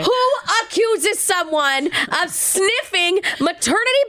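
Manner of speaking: indignant, high-pitched rhetorical question; incredulous indignation. Real, not acted. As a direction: stretch it out in outraged disbelief. Indignant outburst about the clerk.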